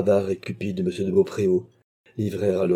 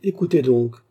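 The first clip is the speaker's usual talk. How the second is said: higher, louder "écoutez donc"; sharp command; 6 semitones higher, 6 dB louder than usual. A narrator's reading. Baronet silences the stunned clerk, louder and higher. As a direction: cut him off firmly.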